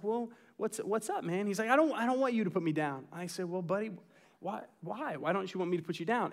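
dad on the bathtub edge asking his son softly; gentle and soft. Retold or imitated. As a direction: ask him quietly, like soothing a four-year-old. Dad gently questions upset son.

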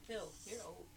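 ordinary talk

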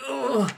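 high mock grunt of effort; straining grunt. Player as Troll Dad holding up the barrier, straining grunt. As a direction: grunt as if heaving a weight. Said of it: "I'm like".

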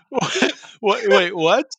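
high, stunned repeated what; shocked disbelief. Real, not acted. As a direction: caught completely off guard. Shocked by camel meat offer.

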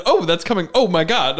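hammed-up alarmed commentary; mock narration. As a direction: pretend to panic while narrating wildlife. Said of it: "And then I'll narrate".

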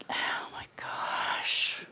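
unvoiced whisper of dread; a mock whisper of despair. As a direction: breathe it out under your breath, slowly. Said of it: "I'm like".

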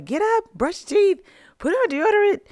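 high, loud panicked orders; frantic yelling. Retold or imitated. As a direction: bark orders at the family in a rush. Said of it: "I'm yelling at everybody".

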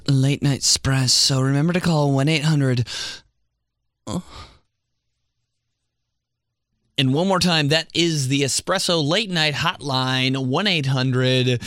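hammed-up late night radio ad voice; mock ad read. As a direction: sell the hotline like a cheesy late-night ad.

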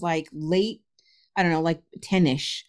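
ordinary talk, nothing marked

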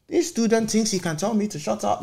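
high-pitched mock announcement to a school assembly; retold teacher's scolding. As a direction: imitate a teacher publicly shaming a pupil.

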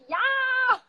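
high, loud put-on yelp; mock eager shout; imitating her yes. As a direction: chirp it like her trademark yes. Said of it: "Ze zegt".

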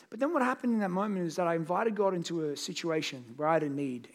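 ordinary delivery

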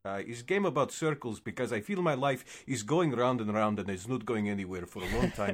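gloomy mock-Russian game developer; deadpan fake Russian English; cartoon Russian accent. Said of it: "you need Russian accent".

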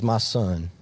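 voce morbida e bassa, paterna; gentle father. Retold or imitated. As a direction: rassicura il figlio con dolcezza. Father gently answers his son.

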